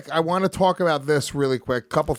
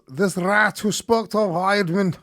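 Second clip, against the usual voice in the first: slow, higher-pitched Irish attempt; bad Irish accent; 7 semitones higher, slower than usual. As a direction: have a clumsy go at Irish. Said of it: "I can't do an Irish accent".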